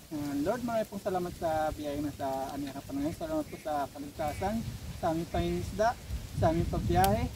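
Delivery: prayer. Acted. Leads grace before eating.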